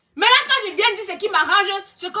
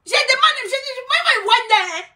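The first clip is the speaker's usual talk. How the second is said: indignant outburst; 6 semitones higher than usual. Real, not acted. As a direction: insist on her own name with outrage. Indignant rant about casting fees. (protestation indignée, voix haute)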